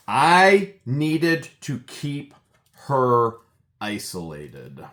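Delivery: I and HER drawn out loud and high; emphatic quote. Retold or imitated. Quoting her damning testimony, slow heavy emphasis. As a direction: hammer "I needed to keep her", very slow, dropping on "isolated".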